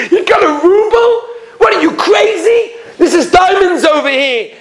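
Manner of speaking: high-pitched incredulous jeer; scornful, laughing disbelief; mocking laughter. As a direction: act out laughing him out of the place. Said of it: "everybody's laughing at him".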